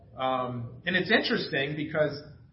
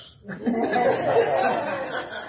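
loud, high burst of laughter; hearty laughing fit; real laugh; 10 semitones higher, 6 dB louder than usual. Real, not acted. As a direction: laugh out at the toothbrush joke. Laughing at his own joke.

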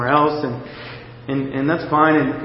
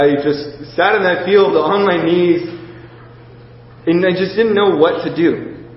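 normal talking voice

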